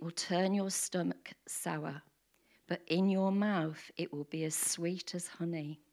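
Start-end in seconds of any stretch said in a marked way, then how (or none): none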